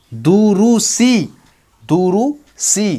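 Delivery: very slow "दुरुसी", ending on long "सी"; zer joining demo. Shown as a demonstration. Teacher changing the last sign to zer, drawn-out "दुरुसी". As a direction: hold every vowel in "दुरुसी". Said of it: "ज़ेर लगा देंगे".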